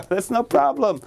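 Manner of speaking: high chuckling boast; smug doctor. Acted. The psychiatrist boasting to the parents, high and chuckling. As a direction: brush it off with a laugh.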